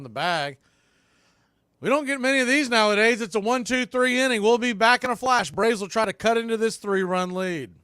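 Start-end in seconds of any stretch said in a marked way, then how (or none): 1.8-7.7 loud, high, fast break call; excited sign-off. Real, not acted. Radio announcer signing off to commercial, loud and fast. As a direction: pump up the listeners going to break.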